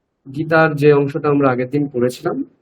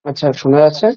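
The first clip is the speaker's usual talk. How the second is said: echo; faster than usual. Real, not acted. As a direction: voice with a room echo. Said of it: "ইকো হচ্ছে সম্ভবত".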